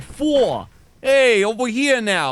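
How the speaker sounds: high, loud, drawn-out mock Bronx accent; fake New York accent. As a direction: mimic a coworker's put-on New York accent. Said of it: "she talks normally, and then when, every once in a while".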